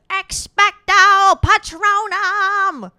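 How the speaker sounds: nasally, high and loud mock spell cry; Harry Potter. Mocking the hero's spell-casting, nasally. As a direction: drag it out high and loud through the nose, mocking. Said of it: "how nasally Harry Potter's voice is".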